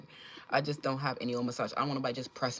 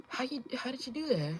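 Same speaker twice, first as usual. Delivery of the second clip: higher, baffled mock voice; imitating the confused critics; 9 semitones higher, 3 dB quieter than usual. Mocking the people who can't figure out her fix, baffled high voice. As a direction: play them clueless and amazed. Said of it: "They like".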